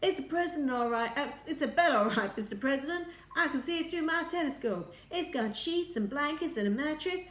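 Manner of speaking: high, loud, eager astronaut; nervous insisting. A narrator's reading. Junior astronaut reporting to an impatient President, nervous insisting.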